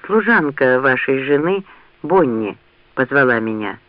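plain reading voice

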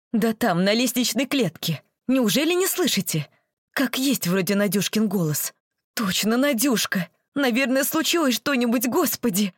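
rising alarm, voice climbing high; worried neighbour hearing a child cry. Acted. Pregnant woman alarmed by crying.

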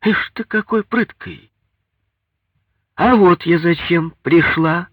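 high-pitched, scornful mother-in-law's comeback; defiant. A narrator's reading. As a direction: challenge him, building to a loud, slow announcement. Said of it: "ответила она с вызовом".

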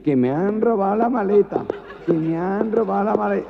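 cantinela aguda entre risas; lamento amanerado. Acted. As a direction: queja cantada y exagerada. Repite el lamento cantarín. (quejido amanerado al guardia)

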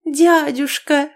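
high, slow joyful cry of "Дядюшка". A narrator's reading. Niece rushing to her uncle, joyful cry. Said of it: "с радостным воплем".

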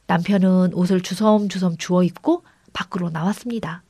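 plain reading voice

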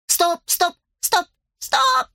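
rising to a thin high squeak; voice getting thinner. A narrator's reading. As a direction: pitch climbing until it squeaks. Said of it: "голос делался всё тоньше".

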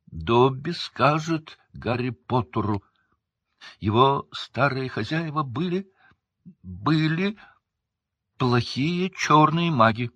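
high, slow, halting elf voice; daring elf. A narrator's reading. Dobby confessing about his old masters, halting and high.

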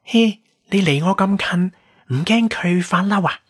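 put-on high-pitched mischievous young woman; playful. A narrator's reading. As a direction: tease him in a high, girlish voice. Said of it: "調皮咁講". (調皮高音女仔聲)

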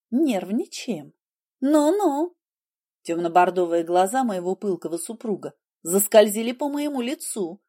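ordinary delivery

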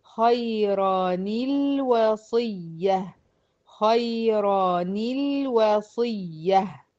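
extremely slow "خيرا الوصية" as khayrani-lwasiyya; drawn-out tajweed recitation of "خيرا الوصية" with ni link; demonstrating tanween link. Shown as a demonstration. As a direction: stretch every syllable of "خيرا الوصية", joining with ni. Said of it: "فهتتنطق ازاي؟".

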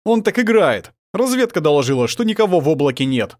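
loud, high outburst easing slightly; heated commander's protest; angry. A narrator's reading. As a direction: argue back angrily. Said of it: "зло произнёс Мирадор".

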